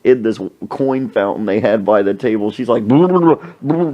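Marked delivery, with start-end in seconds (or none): 2.9-3.9 loud, high lip burble; mock underwater burbling. Waitress rinsing her face in fountain, mock burbling. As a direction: burble as if dunking your head. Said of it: "dunking her head in the coin fountain".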